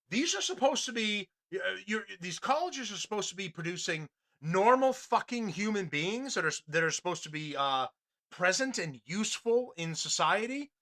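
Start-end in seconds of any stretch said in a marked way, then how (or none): none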